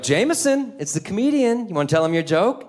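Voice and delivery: high, sing-song parent voice; mock-cheerful prodding of a small child; coaxing parent.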